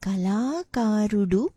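"Kalakarudu" slowly, long "la" and "ka", rising end; model pronunciation. Shown as a demonstration. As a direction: present "Kalakarudu" slowly for repeating. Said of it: "this is how you pronounce these two words".

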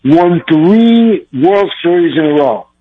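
ordinary talk, nothing marked

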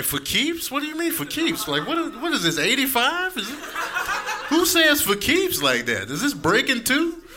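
high, baffled questions; can't believe what he just heard; incredulous. Retold or imitated. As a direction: teller mocks his cousin, high and baffled. Teller's incredulous reply to cousin.

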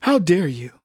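low, flat put-down of offense; deadpan mock indignation. Mocking Fauci fans.

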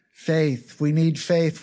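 usual speaking voice

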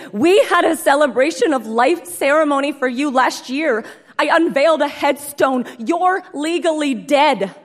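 high, loud, disbelieving; nearly shouting in disbelief; upset shout. Retold or imitated. Distraught ex-fiancé confronts her.

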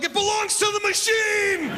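high, slow, shouted and lofty; grand proclamation. Retold or imitated. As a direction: declare it like sacred law. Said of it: "I'm like".

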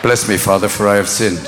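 very low monotone confession; low confessing penitent. Acted. Penitent confessing to the priest, low monotone. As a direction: murmur it far down, all on one note.

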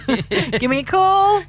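high-pitched mock appeal to a crush; playful plea. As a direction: plead playfully, drawing out the last words. Playful radio plea to her crush.

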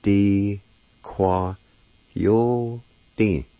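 "Di kwa yo din" said as dee-kwah-yo-deen, syllable by syllable; Cherokee word. Shown as a demonstration. Teacher showing the word for coyote, carefully. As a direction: spell out "Di kwa yo din" slowly and evenly. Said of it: "It's pronounced like this".

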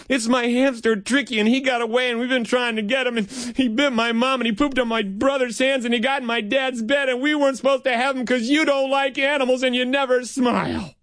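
put-on high, hurried kid's run-on; blurted confession. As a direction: spill it all out without stopping. Boy blurts out the whole confession.

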